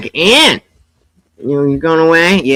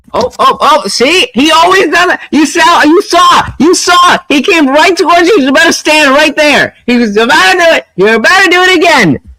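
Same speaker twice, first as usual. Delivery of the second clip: high, fast excited babble; bursting with glee about the dog; excited chatter; 9 semitones higher, faster than usual. Real, not acted. Streamer telling chat what the dog just did, excited.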